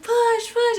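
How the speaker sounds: very high, loud urging; high-pitched delivery-room shout; urgent cheering on. Retold or imitated. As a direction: urge her on at full pitch. Acting out urging during the delivery.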